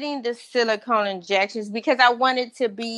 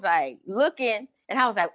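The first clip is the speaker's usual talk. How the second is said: usual speaking voice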